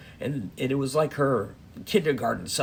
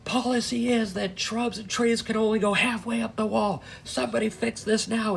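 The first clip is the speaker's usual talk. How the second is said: mock yell; 7 semitones higher than usual. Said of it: "the nurse starts fucking yelling".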